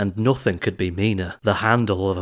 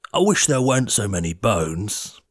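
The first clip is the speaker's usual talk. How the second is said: grumpy giant. A narrator's reading. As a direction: grumble like a sulky giant. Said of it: "and this in grumpy tones".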